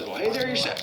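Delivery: very high cartoon tow truck; Mater. Truck answers his younger double, high character voice. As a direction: return the greeting high, rising at the end. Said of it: "Our Mater says".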